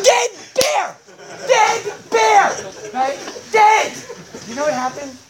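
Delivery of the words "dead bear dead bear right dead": very high, loud cries; upset outburst, hammed-up. Storyteller announces the starved baby bear, hammed-up upset outburst. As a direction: shout it in mock horror. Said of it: "I don't usually get so upset about these things".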